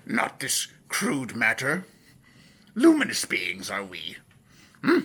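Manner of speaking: Yoda. Acted. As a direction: imitate the little Jedi sage's halting speech. Quotes Yoda in his voice.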